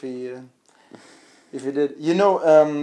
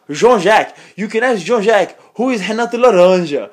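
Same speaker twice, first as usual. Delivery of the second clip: put-on high, loud Brazilian-accented boasting; thick Brazilian accent; 6 semitones higher, 7 dB louder than usual. Retelling how he talked down Jean-Jacques, mock Brazilian accent. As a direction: imitate a condescending Brazilian jiu-jitsu braggart. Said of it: "he talks with this Brazilian accent, really thick".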